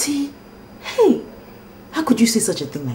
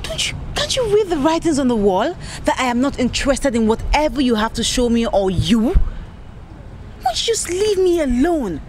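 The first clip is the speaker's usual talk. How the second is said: high, pleading brush-off; exasperated; 6 semitones higher than usual. Acted. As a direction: push him away, fed up. Exasperated girl rebuffing persistent suitor.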